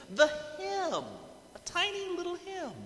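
"The hem" lifted high, "a tiny little hem" squeezed small and soft; stress on hem. Shown as a demonstration. Preacher marvelling at the hem to congregation, high and hushed. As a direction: make "hem" sound tiny and precious. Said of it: "I noticed this part".

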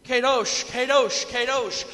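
loud ringing calls of "Holy" then "Kadosh"; shouted proclamation. Acted. Seraphs calling back and forth across heaven, shouting loud. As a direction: shout it across to the other side. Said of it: "shouting this, proclaiming".

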